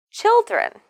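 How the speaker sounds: put-on "Children." high on the first syllable, short second; first syllable stressed. Shown as a demonstration. Teacher models the stressed syllable, first syllable punched. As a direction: punch the start of "Children." high, then fall. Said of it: "we need to stress the first syllable".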